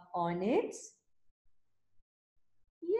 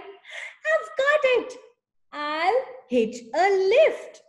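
excited cry; 9 semitones higher, 7 dB louder than usual. Acted. Snail cries out her idea, excited high shout. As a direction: burst out with the big idea. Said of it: "then cried".

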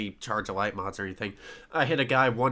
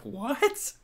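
baffled what; 13 semitones higher than usual. Retold or imitated. The squad reacting to the Dorito excuse, a high baffled squeak. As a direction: squeak it out in disbelief. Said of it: "we're all just like".

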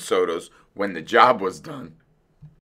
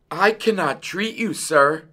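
higher-pitched shouted refusal; mild shout; 8 semitones higher than usual. A narrator's reading. Dentist mouse to fox at the door, mildly shouted. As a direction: call out, refusing firmly. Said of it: "Doctor DeSoto shouted".

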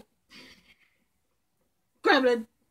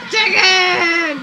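much higher, loud yell of defeat; frustrated shout; 7 semitones higher, 9 dB louder than usual. Real, not acted. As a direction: yell it when caught. Caught again, shouts.

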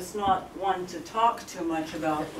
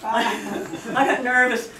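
laughing through it, a bit higher and louder; nervous laugh; 4 semitones higher, 5 dB louder than usual. Real, not acted. Telling family how she met Koreans, laughing nervously. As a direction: let the nerves spill out as a laugh.

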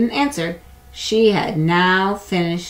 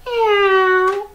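very high drawn-out meowing; cat meow; 6 dB louder than usual. Acted. The cat calls to the spider, very high meowing. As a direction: cry it like a cat. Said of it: "cried the cat".

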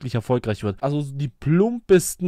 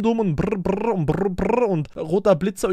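higher, rising mock chant of "dumm und brr"; mocking the hook; 4 semitones higher than usual. Streamer mimics the singer's cringe rising stress, as a mock chant. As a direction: parody her rising stress on each word. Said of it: "die Betonung gepaart mit diesem dumm".

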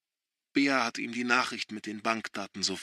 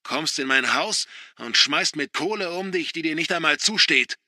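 louder, higher angry accusation; 5 semitones higher, 5 dB louder than usual. A narrator's reading. Father accusing son, angry and loud. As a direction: jab the words at him in anger. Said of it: "zeigt wütend".

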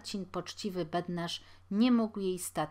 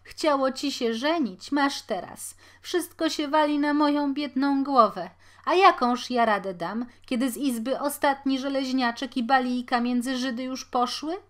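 louder, higher wailing reproach; sobbing lament; 7 semitones higher, 9 dB louder than usual. A narrator's reading. Mother to her husband, wailing and sobbing. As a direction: sob out the blame.

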